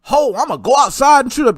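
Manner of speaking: loud, high, hammed-up shout; mock gamer hype. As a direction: play the crazed gamer the critics imagine. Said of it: "Video games don't make people go".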